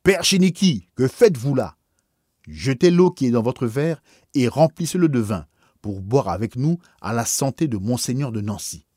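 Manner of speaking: loud, high, trembling with rage; shaking with anger. Acted. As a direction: cry out, barely holding back fury. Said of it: "D'une voix tremblante de colère, il s'écria".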